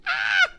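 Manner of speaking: high loud fan shriek; excited scream. Retold or imitated. Watching Hannibal, a high thrilled shriek. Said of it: "I was screaming through all of it".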